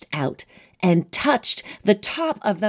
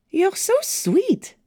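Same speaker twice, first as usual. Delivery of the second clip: high, slow, sweet princess voice; cartoon princess; 11 semitones higher, slower than usual. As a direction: coo it like a storybook princess. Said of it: "said the princess to Despero".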